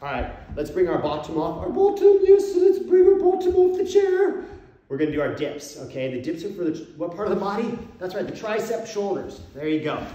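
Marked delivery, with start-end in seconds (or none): 1.6-4.4 put-on very high, loud falsetto English accent; hammed-up English lady voice, Mrs Doubtfire style; mock English accent. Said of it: "my English accent".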